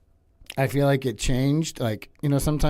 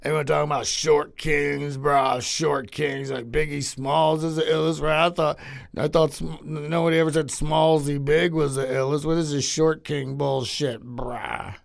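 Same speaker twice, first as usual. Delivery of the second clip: Brody-style bro voice; 2 semitones higher than usual. Acted. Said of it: "is that a Brody joke?".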